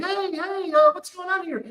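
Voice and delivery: put-on high, bouncy girlfriend cutting in. Girlfriend breaks into the crowd, high and breezy. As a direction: pitch it up like a cheerful girlfriend. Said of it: "she grabs me and she's like".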